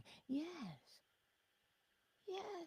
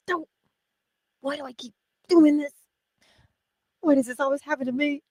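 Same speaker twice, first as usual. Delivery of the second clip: louder, fast exasperated whine at yourself; frustrated self-complaint; 6 semitones higher, 6 dB louder, faster than usual. Retold or imitated. After smacking the forehead, an exasperated whine. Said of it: "we smack our forehead and go".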